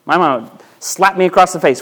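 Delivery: ordinary delivery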